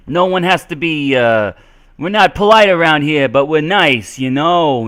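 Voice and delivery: loud, high, brash New Yorker act; New York accent. Acted. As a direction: play a pushy New Yorker with a broad accent. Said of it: "in the New York accent".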